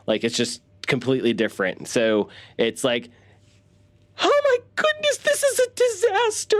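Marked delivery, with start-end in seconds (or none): 4.2-6.6 much higher, overly dramatic fretting voice; anxious Jake the worrier, way up high; high-pitched worry wart. Retold or imitated. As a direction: squeak it out like a panicky nerd. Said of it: "This high-pitched kind of worry wart".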